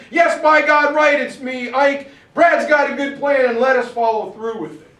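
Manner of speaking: loud, high, emphatic shout; Patton shouting back. Retold or imitated. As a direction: holler it toward the phone. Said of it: "And he says it loud".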